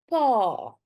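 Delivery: "pa" with a long, drawn-out A; long vowel. Shown as a demonstration. Teacher introduces the Afrikaans word for dad, "pa" held long. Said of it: "it's a long sound".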